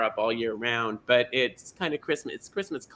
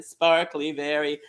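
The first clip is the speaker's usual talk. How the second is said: ordinary delivery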